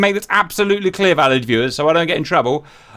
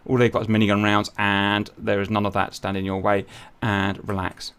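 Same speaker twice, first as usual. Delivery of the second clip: much softer and lower; quiet; 9 semitones lower, 7 dB quieter than usual. Real, not acted. Commander easing off after giving orders, quiet. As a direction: settle back and exhale.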